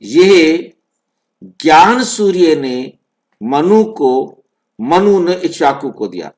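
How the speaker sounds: "यह" isolated, then "ज्ञान सूर्य ने" as one unit; pause after यह. Shown as a demonstration. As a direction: stop after "यह", keep "ज्ञान सूर्य" whole. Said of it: "अंतर समझ में आ रहा है?".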